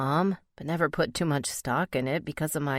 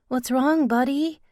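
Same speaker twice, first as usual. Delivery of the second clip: worried; 10 semitones higher, 5 dB louder than usual. Acted. Asking the sick child again.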